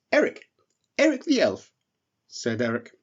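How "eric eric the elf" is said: high, eager little elf voice; a small relieved voice rushing to please. Acted. Eric offers the bear soup, high and relieved.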